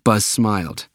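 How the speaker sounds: plain reading voice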